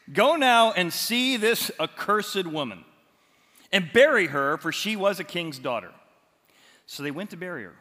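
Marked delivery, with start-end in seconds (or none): 0.1-5.9 royal command. Acted. Jehu ordering his servants to bury her, loud formal command. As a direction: proclaim it like a king. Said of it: "And then he decides".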